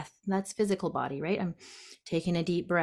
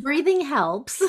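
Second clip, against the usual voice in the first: slow, higher and louder, leaning on the obvious answer; playful emphasis; 7 semitones higher, 4 dB louder, slower than usual. Acted. Teasing the host with the obvious answer, slow and emphatic. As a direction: say it like the punchline everyone knew.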